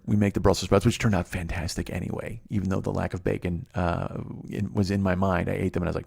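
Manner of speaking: ordinary delivery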